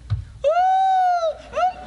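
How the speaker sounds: high falsetto wailing ooh; mock hired mourner wail. Preacher mocking paid mourners wailing in the street, hammed-up high wail. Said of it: "when the body's going down the street".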